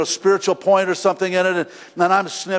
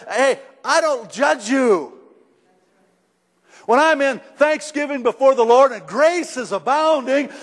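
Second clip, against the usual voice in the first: normal talking voice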